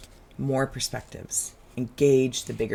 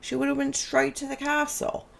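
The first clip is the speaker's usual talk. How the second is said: very high mock Australian worm voice; Australian accent; 14 semitones higher than usual. Worm lamenting Sarah's wrong turn, high imitated Australian accent. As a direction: squeak it out as a little Australian worm. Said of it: "he has an Australian accent".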